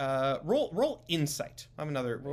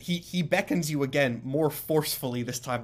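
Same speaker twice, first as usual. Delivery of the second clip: usual speaking voice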